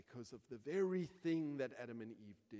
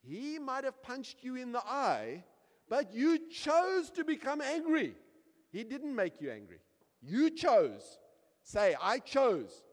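high, loud, insistent scolding; emphatic parental correction; 9 semitones higher, 11 dB louder than usual. Acted. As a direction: drive the lesson home to a child, loudly. Acts parent firmly correcting angry child.

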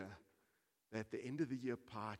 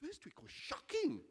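insistent protest; 7 dB louder than usual. Acted. Speaker insists on the bad week.

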